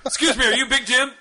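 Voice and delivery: high-pitched play-acted officer question; hammed-up officer checking a name; mock arresting officer. Officer approaching Big Jim over his joke, mock official.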